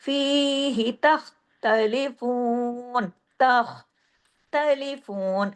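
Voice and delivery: slow recitation, long फी, short ही, held oo in तख्तलिफून; measured madd. Shown as a demonstration. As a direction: stretch फी and फून, keep ही brief. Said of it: "ही को एक हरकत ही खींचना है". (फीही तख्तलिफून, सही मद के साथ)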